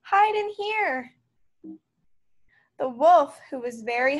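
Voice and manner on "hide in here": high, stretched-out call; urgent cry. A narrator's reading. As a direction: call the brothers in to hide. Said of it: "cried the third little pig".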